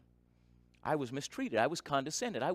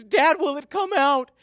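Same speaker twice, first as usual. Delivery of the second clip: high, loud, slow plea through tears; imitating his bawling son, high and loud; tearful teen plea; 11 semitones higher, 11 dB louder than usual. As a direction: beg like a crying kid. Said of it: "he turns to me and says".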